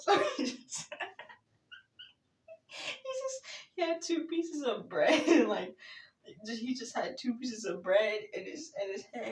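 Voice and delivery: giggling through the words, voice jumping high; barely getting it out between laughs; laughing. Real, not acted. Dad's burger falls apart, laughing.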